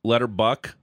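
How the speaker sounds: normal talking voice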